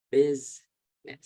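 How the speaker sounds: Business stretched slowly and loudly into two beats; slow syllable drill. Shown as a demonstration. Teacher drilling the class on syllables, slow and loud. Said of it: "So there are two syllables".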